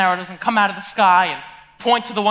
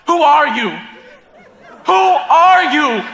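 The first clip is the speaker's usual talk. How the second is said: laughing disbelief; 6 semitones higher, 8 dB louder, slower than usual. Retold or imitated. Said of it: "you think to yourself".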